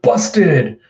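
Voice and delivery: loud put-on exclamation; caught-out yelp. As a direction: blurt it out loudly. Caught in retold chase.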